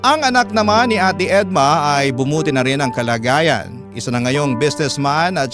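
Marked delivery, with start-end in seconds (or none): none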